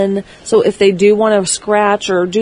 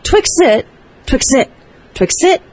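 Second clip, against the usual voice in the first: high, sharp, insistent dog command; anxious repeated command; 5 semitones higher than usual. Shown as a demonstration. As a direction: fire the same cue at the dog over and over, pitched up. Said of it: "But if you go".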